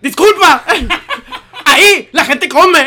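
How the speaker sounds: very high, very loud indignant yell; hammed-up outburst, slowing on the rebuke; angry scolding. Barista scolds customer with feet on table, furious mock shout. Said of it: "Y yo así enojado".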